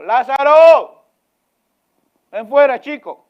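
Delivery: loud, high, slow shout into the tomb; commanding call. Pretend. Jesus shouts at the tomb for Lazarus, loud and high. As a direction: call the dead man out.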